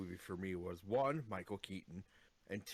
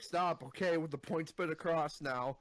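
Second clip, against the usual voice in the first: urgent pleading; 6 semitones higher, 4 dB louder than usual. Retold or imitated. Acting out a character pleading.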